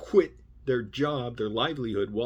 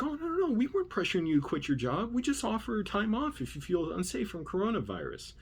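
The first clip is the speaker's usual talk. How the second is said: high, singsong mimicry of backpedaling; mock nice; 10 semitones higher than usual. Managers denying they pressured a worker to quit, mock-nice and high. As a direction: mimic their sudden niceness in a much higher voice. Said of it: "they're so very nice".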